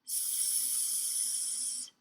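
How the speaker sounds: "S-" held as a long hiss; drawn-out S sound. Shown as a demonstration. Teacher sounding out spots for children, drawn-out hiss. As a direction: sound out "S-" as one long hissed sound. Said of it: "let's write on the word spots".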